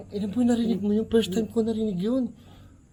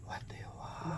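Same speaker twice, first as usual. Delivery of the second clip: breathy whisper, asking the unseen; 2 semitones lower, 21 dB quieter than usual. Real, not acted. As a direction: whisper the question into the dark. Asks the spirit in whisper. (pabulong na tanong)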